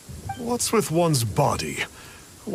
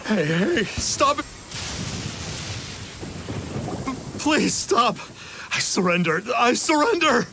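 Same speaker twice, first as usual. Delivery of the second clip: higher, rising cries for mercy; panicked pleading; 6 semitones higher than usual. Acted. Beaten fraud surrendering to his attackers, panicked pleading. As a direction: beg them to stop, voice climbing.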